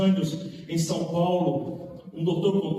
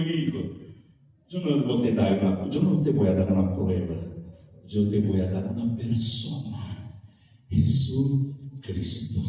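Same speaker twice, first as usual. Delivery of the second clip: gentle pastor reply; 6 semitones lower than usual. Retold or imitated. Old pastor answers gently.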